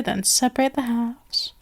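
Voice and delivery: hushed whisper while working. Acted. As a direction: let it fall to a whisper. Cutting the orange.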